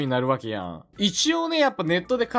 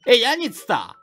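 mocking net users; 3 semitones higher than usual. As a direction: ridicule his dialect like an online troll. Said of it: "ってバカにされるからね". (ネットの人がからかう口調)

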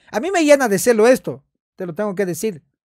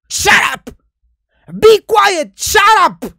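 mock angry shout; 7 semitones higher, 4 dB louder, much slower than usual. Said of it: "shut up ya es como un insulto".

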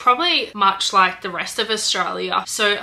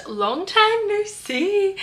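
sing-song greeting; 11 semitones higher, much slower than usual. Acted. Playful greeting returning to channel.

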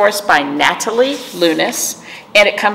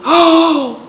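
surprised gasp; 9 semitones higher than usual. Real, not acted. Sudden idea, gasp heard.

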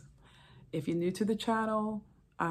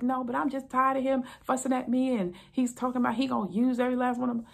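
complaining wife; 6 semitones higher, 4 dB louder, faster than usual. Retold or imitated.

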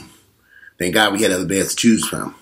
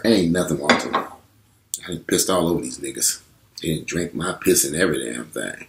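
lower, softer, casual bragging voice; nonchalant guy; 7 semitones lower, 6 dB quieter than usual. Retold or imitated. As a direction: drop low and shrug it off like it's nothing. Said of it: "And people tell me".

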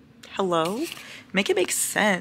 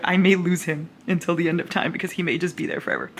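ordinary talk

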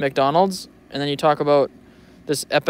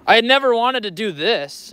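mock dramatic title; 7 semitones higher than usual. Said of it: "one of these".

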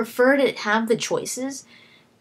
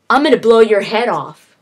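louder, like a stiff game hero; mock same-tone line; 8 dB louder than usual. Mocking Deus Ex hero's unchanging delivery, loud. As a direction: say it loud, same tone whatever the words. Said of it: "it's the same thing".

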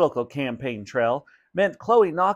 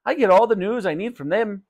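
shopkeeper voice, pitched up; breezy higher character voice; Raj; 7 semitones higher, 3 dB louder than usual. Acted. Shopkeeper explains his reading to mother, breezy.

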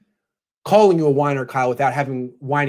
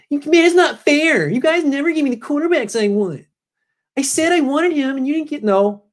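put-on high, whining spoiled-kid voice; whiny Kyle; 11 semitones higher than usual. Mocking coach Kyle, complaining to his bosses in a high whine. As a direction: whine like a kid who didn't get his way. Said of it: "does Kyle whine like that".

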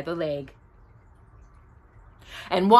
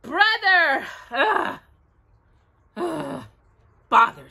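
exasperated; 6 semitones higher, slower than usual. A narrator's reading. Pooh is vexed at the answer.

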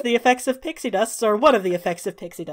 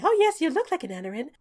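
high, bright fairy voice; squeaky little fairy greeting; tiny pixie voice; 6 semitones higher, faster than usual. Acted. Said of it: "flits up ... and says".

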